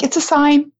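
high-pitched, comic doom-saying; hammed-up worrying; mock alarm. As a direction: play up dread of the trip. Acts out panic before the trip.

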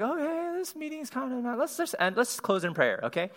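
high-pitched, hurried wrap-up of a tense meeting; mock-anxious high voice; awkward conflict avoidance. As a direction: squeak out a nervous excuse to end it, easing down in pitch. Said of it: "my first response to conflict".

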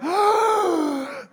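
high, sharp imitated gasp; kids' gasp. Dad imitating his sons' shock, high gasp. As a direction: gasp like a startled little kid. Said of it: "they're like".

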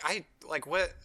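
quiet, halting imitation of being flustered; stumbling over words. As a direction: fumble softly for the words. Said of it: "stumbling over her words".

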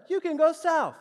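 high, loud punchline; comic astonishment, voice shooting up; mock amazement. Real, not acted. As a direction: hit the reveal in loud, high-pitched disbelief. Comic reveal after wouldn't you know.

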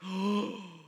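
big drawn-in gasp of amazement; mock gasp. Players seeing the Clue cards revealed, theatrical gasp. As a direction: suck in air as if stunned. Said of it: "everybody goes".

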